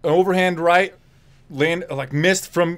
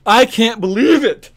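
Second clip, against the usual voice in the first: ordinary delivery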